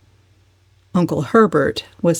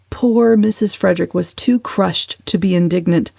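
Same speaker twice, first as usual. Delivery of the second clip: usual speaking voice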